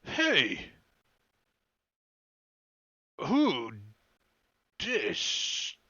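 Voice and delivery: halting ogre challenge, word by word; slow-witted ogre calling out. Acted. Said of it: "He's like".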